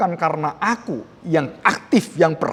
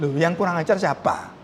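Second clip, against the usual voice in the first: ordinary talk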